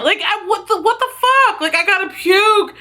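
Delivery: high, squeaky what-the; panicked disbelief. Retold or imitated. Cat blocks her, about to vomit.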